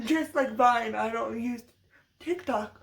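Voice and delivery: normal talking voice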